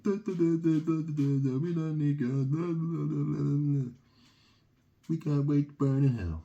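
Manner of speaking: mock them with loud dismissive blah-blah; mocking relatives. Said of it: "over in Wales are like".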